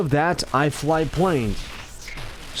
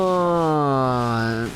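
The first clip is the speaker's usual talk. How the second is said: long, low, drawn-out groan; exasperated groan; 5 semitones lower than usual. Real, not acted. Annoyed at beating himself up, long low groan.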